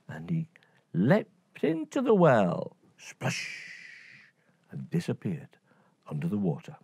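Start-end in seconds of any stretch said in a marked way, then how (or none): 3.0-3.5 breathy whispered splash; hushed splash sound; soft splash. A narrator's reading.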